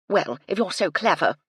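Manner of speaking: snapped. Acted. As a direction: bite back. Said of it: "Phoebe snapped".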